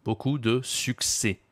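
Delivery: "succès" said slowly as "suk-seh", final S kept silent; slow teacher model, "succès" without the final S; slow model pronunciation. Shown as a demonstration. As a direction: model "Beaucoup de succès" for the learner, no S at the end. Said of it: "Tu ne prononces pas le S à la fin".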